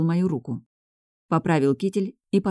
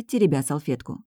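ordinary delivery

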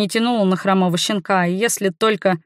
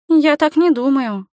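put-on higher-pitched girl, drawn-out assurance; higher, pleading girlish reassurance; hasty girl; 7 semitones higher, 3 dB louder than usual. A narrator's reading. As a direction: voice a girl anxious to assure him. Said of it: "поспешно заверила девчонка".

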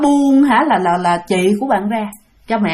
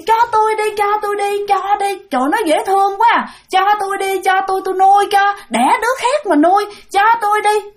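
high, wheedling begging voice, not fierce; pleading ghost woman; 8 semitones higher than usual. Retold or imitated. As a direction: coax the mother to hand over the baby. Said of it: "không phải là dữ tợn mà bà chửi đâu, mà bà nói vậy nè". (giọng bà ma năn nỉ, ngọt ngào xin đứa bé)

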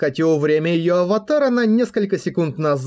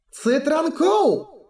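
very high, slow drawn-out cry; high exclaimed spell; 12 semitones higher, much slower than usual. A narrator's reading. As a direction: call out the spell in a high girl's voice. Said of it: "воскликнула она".

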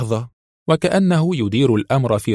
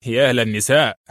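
exasperated, lightly growled exclamation; mild growl. A narrator's reading. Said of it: "وأشاح بوجهه مزمجرا".

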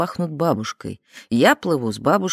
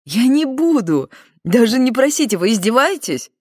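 indignant; 6 semitones higher, 4 dB louder than usual. Acted. Refusing to sniff the arm.

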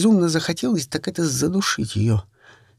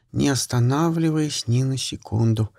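usual speaking voice

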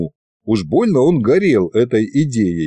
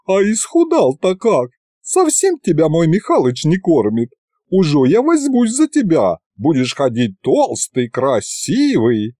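higher, doting, chattering woman; fussing village aunt; 5 semitones higher than usual. Acted. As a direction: cluck over him like a caring aunt. Yaguta fusses over the thin boy. (заботливая тётка, высоким голосом)

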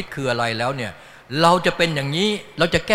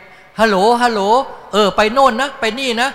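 higher, louder mock phone call, chatty; phone chatter; 7 semitones higher, 5 dB louder than usual. Kids at school distracted by phone calls, hammed-up higher chatter.

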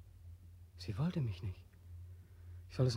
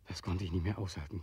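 despairing whisper; whispered breaking point; 6 semitones lower, 6 dB quieter than usual. Acted. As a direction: barely voice it, at the end. Despair before suicide.

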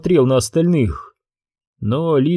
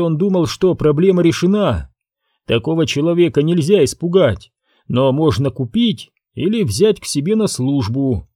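plain reading voice

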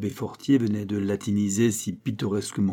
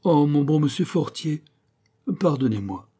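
higher opening, falling into entreaty; pleading; 6 semitones higher than usual. A narrator's reading. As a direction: beg the master's forgiveness. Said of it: "une autre voix suppliante". (voix suppliante)

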